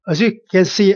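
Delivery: ordinary talk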